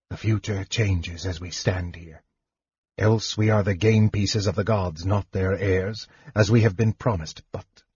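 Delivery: soft, weary sorcerer trailing away; a frail mage's quiet musing; Raistlin, spent mage. Acted. Mage to Tanis on the gods' game, softly spent.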